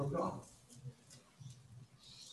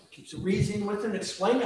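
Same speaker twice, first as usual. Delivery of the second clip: ordinary delivery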